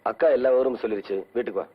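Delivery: loud, low-pitched insistence; firm command. Acted. Uncle telling the girl to come home, firm command.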